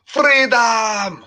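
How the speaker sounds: high, loud battle cry; Braveheart freedom cry. Retold or imitated. Echoing the movie speech to friends, a loud high cry. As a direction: bellow it like a dying rebel. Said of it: "William Wallace".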